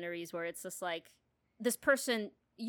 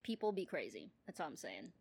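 usual speaking voice

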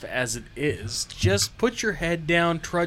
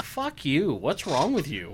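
ordinary talk, nothing marked

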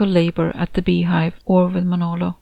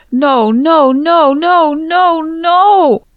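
high, loud anguished wail; howling grief; 10 semitones higher, 7 dB louder, much slower than usual. Acted. Grieving mother howls at her daughter's death, anguished. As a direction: howl like a wounded animal. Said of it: "A howl like a wounded animal".

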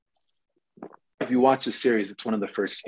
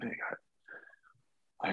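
slow whispered repeat; 13 dB quieter, much slower than usual. Retold or imitated. To the sound guy, slow whispered repeat. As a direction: whisper it again, drawn out slowly.

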